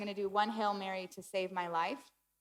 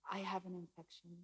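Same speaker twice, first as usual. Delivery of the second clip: much softer, almost under the voice; reluctant confession; 2 semitones lower, 11 dB quieter than usual. Retold or imitated. Patient telling the receptionist her reason, hushed and reluctant. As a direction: admit it quietly, keeping it small.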